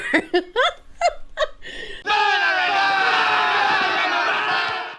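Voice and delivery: big burst of laughter; streamer cracking up at his witch joke. Real, not acted.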